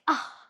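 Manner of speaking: Ah as short u like umbrella; a short u for Ah as it pops open; short U sound. Shown as a demonstration. Teacher acting out the stuck umbrella, short u. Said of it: "it pops open and you say".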